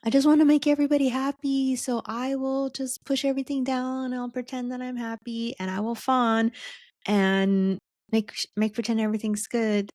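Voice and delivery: high, fast, eager-to-please gush; people-pleaser voice. Retold or imitated. Host voicing the fawn type to listeners, in a high, fast, eager-to-please gush. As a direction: gush it out brightly like someone desperate to keep everyone happy. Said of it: "And you're like".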